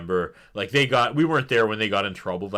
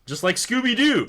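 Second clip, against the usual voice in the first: high, loud burst of glee; excited; 12 semitones higher, 5 dB louder than usual. Real, not acted. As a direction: blurt it out delighted. Gleeful cartoon reference.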